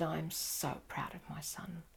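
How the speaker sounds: soft, slow, near-whispered father's words; quoting the proud father while welling up; choked up. Real, not acted. Teacher recalling a proud father, voice breaking with emotion. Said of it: "I'm welling up now".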